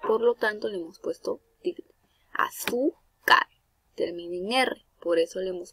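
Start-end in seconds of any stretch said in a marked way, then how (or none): 2.3-3.5 "Azúcar" drawn out slowly, ZÚ lifted high; slowly syllable by syllable, leaning on ZÚ in "Azúcar"; stress on middle syllable. Shown as a demonstration. Teacher giving a llana example, "Azúcar" slow with stressed ZÚ. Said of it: "La mayor fuerza de voz recae".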